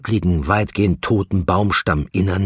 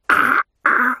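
two high, hurt gasps; sharp high "ah, ah"; acted pained "ah"; 5 dB louder than usual. A narrator's reading. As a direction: yelp in pain, twice. He hurts as she squeezes.

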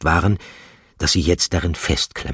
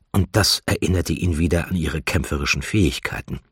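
ordinary delivery

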